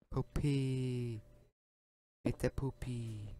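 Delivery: cooing to the dog. Real, not acted. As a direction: coo it gently to your fluffy dog. Cooing pet names at dog.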